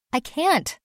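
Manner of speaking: high, strained refusal; a scared boy pleading off; pitched high with anxious strain. A narrator's reading. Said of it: "Basil's voice was strained and anxious".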